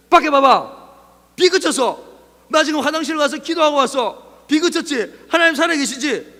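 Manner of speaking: high, loud, urgent proclamation; triumphant excitement. Acted. Believer showing the doubting friend, triumphant shouting. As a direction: call out the proof excitedly.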